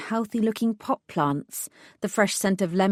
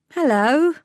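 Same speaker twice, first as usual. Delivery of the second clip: friendly greeting; 8 semitones higher, 6 dB louder than usual. Acted. Narrator greets a child.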